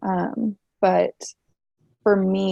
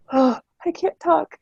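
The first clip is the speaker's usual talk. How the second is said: choked-up tears; 8 semitones higher than usual. Retold or imitated. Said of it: "I'm crying".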